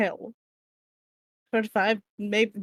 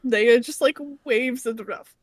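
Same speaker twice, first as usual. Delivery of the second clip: ordinary talk, nothing marked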